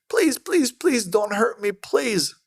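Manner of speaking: high, pleading whimper of a beaten man; mock begging. Beaten man on the ground begging not to be hurt, high pleading. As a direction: beg for mercy in a high squeal. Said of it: "falls on the ground and goes".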